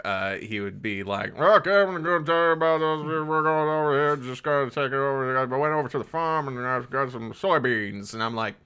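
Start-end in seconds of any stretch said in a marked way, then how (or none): 1.4-8.1 run-together North Carolina mumble, pitched up; North Carolina mountain accent. Retold or imitated. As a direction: mimic an unintelligible rural North Carolina grandpa. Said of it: "his accent didn't translate well through static".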